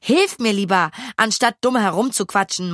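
ordinary delivery

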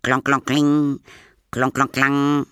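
loud, high metallic clanking noises; imitated key clatter. A narrator's reading. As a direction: clank it out like a key along railings. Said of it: "klackerte Paul mit dem Schlüssel". (lautes, hohes Schlüsselklackern)

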